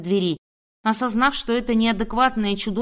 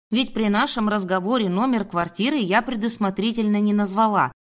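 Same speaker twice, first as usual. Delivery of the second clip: plain reading voice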